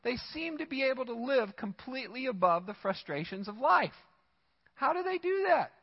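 indignant psalmist asking how the wicked get ahead; exasperated psalmist. Retold or imitated. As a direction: voice climbing in disbelief. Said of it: "This guy's like".

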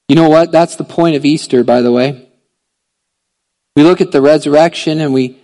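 loud, driving sermon voice; preaching at full force. Acted. Pastor preaches Easter hope to the church, loud.

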